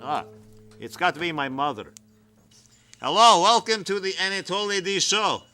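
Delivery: usual speaking voice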